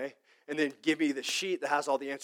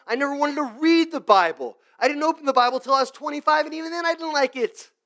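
impassioned testimony; 10 semitones higher, 10 dB louder than usual. Real, not acted. Testimony rising, much higher.

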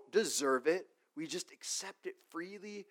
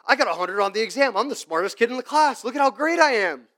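put-on smug bragging kid, higher and louder; mock boasting; 6 semitones higher, 9 dB louder than usual. Pastor mocking a bragging student, smug and loud. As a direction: puff up like a show-off student.

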